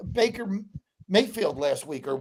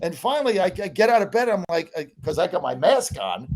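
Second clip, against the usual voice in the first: usual speaking voice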